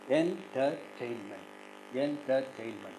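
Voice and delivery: "entertainment" slowly split in four; measured "entertainment", syllables separated. Shown as a demonstration. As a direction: say "entertainment" as en, ter, tain, ment. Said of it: "how to syllabify this".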